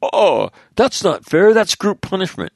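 high, loud whiny objection, hammed up; mock protest. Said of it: "it's in your interest to say".

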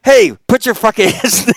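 high, loud laughing scold; telling off. Retold or imitated. Jeff scolding the Marines, a high loud mock scold. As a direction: order the hands down.

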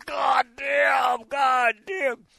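high, drawn-out mock cursing; imitated pained yelling. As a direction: mimic a man howling as he limps in. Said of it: "he's screaming, he's holding his leg".

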